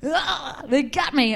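high, slow mock panic then a fake whimper; mock cops panic. Comic to the crowd, hammed-up fear of cops. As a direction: play-act being busted, hammed up.

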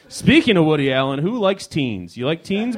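ordinary talk, nothing marked

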